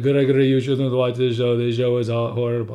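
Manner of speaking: put-on dad's thick Mexican accent, scolding loudly; stern Mexican father with a heavy accent. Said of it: "that's my dad's Mexican accent".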